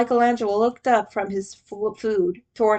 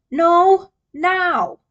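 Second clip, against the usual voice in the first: louder, higher, clipped command; a touch of a stern leader; firm insistence; 7 semitones higher, 6 dB louder than usual. A narrator's reading. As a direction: Leo cutting off the game to talk. Leo insists, drags reader away.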